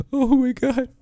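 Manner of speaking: slow, hushed gasp; gasping surprise. Real, not acted. A streamer gasps at the game scene. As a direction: breathe it out in shock.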